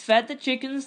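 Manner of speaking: plain reading voice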